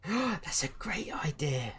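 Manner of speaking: gasping, whispered and slow; child thrilled by the idea, whispered; breathless child delight. Acted. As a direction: gasp it out like a thrilled kid.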